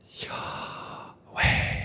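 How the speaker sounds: "Yahweh" as pure breath, inhaled and exhaled, no voice; breathed pronunciation. Shown as a demonstration. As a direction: breathe "Yahweh" slowly, one whispered breath per syllable. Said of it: "breathe the name of God".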